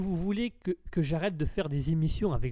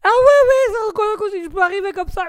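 very high, loud forced squeal; high-pitched voice; 13 dB louder than usual. Shown as a demonstration. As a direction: jump right up into a shrieky register. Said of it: "du grave à l'aigu comme ça". (voix très aiguë, criarde)

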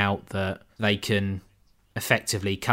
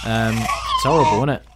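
laughing; 5 semitones higher, 4 dB louder than usual. Real, not acted. Laughs at the chattering scene.

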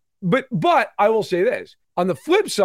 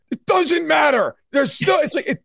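high indignant shout; hammed-up fan outrage; mock outraged fan; 8 semitones higher than usual. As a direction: play the furious fan shouting back. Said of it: "lose their shit every time".

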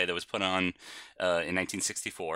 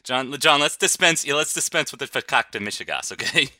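put-on Yiddish accent; 6 semitones higher, 6 dB louder than usual. Mock Yiddish scolding brother.

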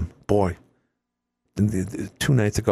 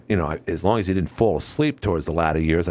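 ordinary talk, nothing marked

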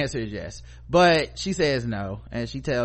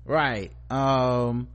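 normal talking voice

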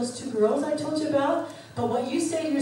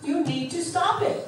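high, slow exclamation; a mild mock of a husband pleading; husband's urgent plea; 6 semitones higher, slower than usual. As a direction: imitate him pleading hard. Husband scolding her, quoted.

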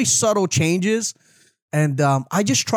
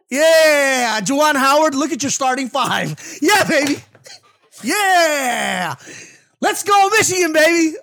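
loud, high fan cheer; excited cheering; 7 semitones higher, 3 dB louder, slower than usual. Real, not acted. Cheering for Michigan.

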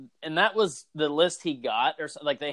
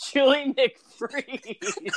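high, slow laughing cry; giggling out the silly name; laughing shout; 9 semitones higher, much slower than usual. Real, not acted. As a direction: crack up as you say it.

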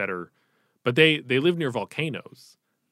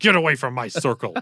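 mock Kylo Ren voice; 3 semitones higher, 7 dB louder than usual.

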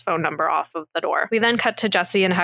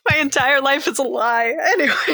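laughing dismay; 8 semitones higher than usual. Real, not acted. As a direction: laugh it off in mock despair. Laughing at a movie twist.

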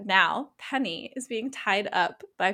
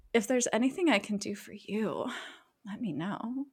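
sexy voice; 7 dB quieter than usual. Retold or imitated. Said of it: "he says it, like, very sexily".

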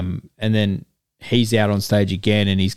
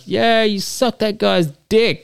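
mocking crowd voice; 11 semitones higher than usual.

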